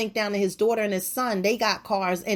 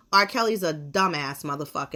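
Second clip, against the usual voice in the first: normal talking voice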